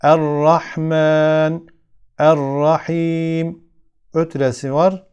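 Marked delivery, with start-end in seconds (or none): 0.0-3.7 "Er-Rahman, Er-Rahim" with a heavy, thick r and long-held last vowel. Shown as a demonstration. Teacher shows the ra with üstün, "Er-Rahman, Er-Rahim" slow with thick r. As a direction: recite "Er-Rahman, Er-Rahim" slowly, giving the r a full, dark sound. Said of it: "kalın okuyorum".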